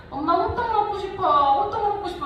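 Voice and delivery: put-on high-pitched little boy pleading with mom; imitated child's plea; begging child. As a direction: voice a young son whining for a snack. Voices young son begging for hotteok.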